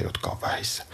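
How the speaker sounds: soft whisper, fading out; whispered trail-off. Acted. Host whispering fridge advice to husband. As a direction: let it drop to a whisper.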